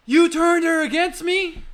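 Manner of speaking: high, loud, slow furious accusation; acted Anakin outburst. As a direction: hurl the film line in melodramatic anguish. Acting Anakin in the scene.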